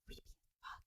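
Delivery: put-on hushed whisper of a creeped-out kid. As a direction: breathe it out under your breath. Retold creepy feeling as a child.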